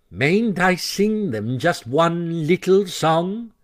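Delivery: piteous plea. A narrator's reading. Toad begging his friends for one song, piteous. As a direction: beg like a sulking child. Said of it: "he pleaded piteously".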